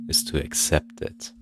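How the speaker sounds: menacing whisper. Acted. Intruder telling him to accept it, whispered menace. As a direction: hush it like a threat.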